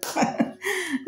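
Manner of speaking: short laugh. Real, not acted. Laughs after joke on price.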